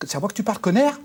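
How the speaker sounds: a hint of a Corsican accent, high and sharp; mock tough Corsican heckle, rising on connard. The dog's voice in a Corsican accent, rising. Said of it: "une voix qui m'a dit ... fort accent corse".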